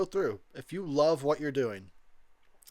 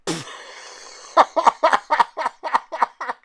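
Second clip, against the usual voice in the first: a long loud laughing fit; a helpless burst of laughter; a real belly laugh; 10 semitones higher, 9 dB louder than usual. Real, not acted. Laughs at the hair.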